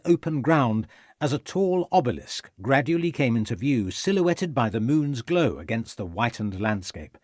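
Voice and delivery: ordinary delivery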